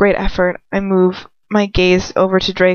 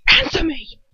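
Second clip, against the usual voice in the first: higher, sharp command; angry demand; 9 semitones higher than usual. A narrator's reading. Lucius demanding an answer after the slap, angry. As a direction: snap the order out.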